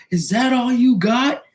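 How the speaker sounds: high, strained bloodied-tough-guy taunt; mock taunt. Bloodied fighter taunting his opponent, mock taunt. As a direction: imitate a beaten guy taunting. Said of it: "He's like".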